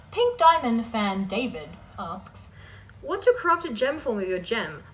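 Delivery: ordinary delivery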